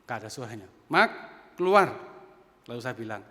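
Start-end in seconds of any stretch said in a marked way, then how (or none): 0.9-1.9 loud call through the bathroom door. Retold or imitated. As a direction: call the child out, firm and loud. Ayah memanggil anak di kamar mandi. (ayah memanggil anak dari balik pintu kamar mandi, berseru keras)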